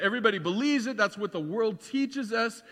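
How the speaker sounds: normal talking voice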